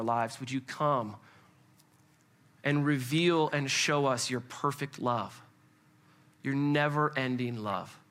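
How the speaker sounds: low, earnest prayer with pauses. Real, not acted. Leads the congregation in prayer.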